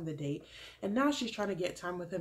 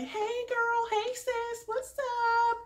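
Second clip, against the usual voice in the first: Crystal; 4 dB louder, much slower than usual. Retold or imitated. Contestant greeting her angry rival, fake high voice. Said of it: "in that stupid voice again, that little fake kind of voice".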